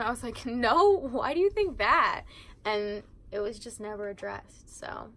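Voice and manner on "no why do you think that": loud, high-pitched feigned disbelief; exaggerated playful denial; mock incredulous denial. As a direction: act baffled, voice jumping up. Retelling mock denial to friend.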